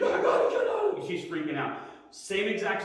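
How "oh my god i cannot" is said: high, loud panicked cry imitating the mother. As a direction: squeal it out like a trapped, frantic mom. Panicked mother in crashed van.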